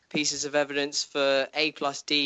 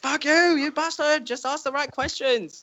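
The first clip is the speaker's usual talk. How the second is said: mock outburst. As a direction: yell at the book in frustration. Venting at Bran while reading.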